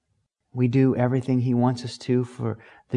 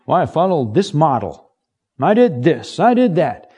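proud self-help boast; 2 semitones higher than usual. Retold or imitated.

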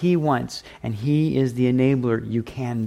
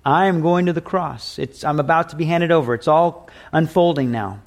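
ordinary talk

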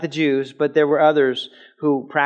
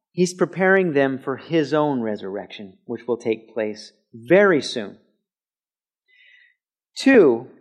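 usual speaking voice

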